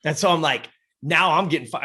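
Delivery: ordinary delivery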